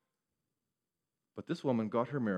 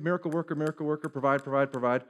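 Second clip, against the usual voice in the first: louder, chanting, grabby begging; mock greedy demand; 2 semitones higher, 4 dB louder, faster than usual. Preacher mocking a believer who just demands miracles. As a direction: nag God like a vending machine.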